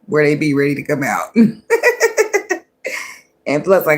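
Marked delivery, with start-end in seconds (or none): 1.4-2.6 a high squealing laugh that breaks out; high burst of laughter. Real, not acted. Laughs at her own comeback.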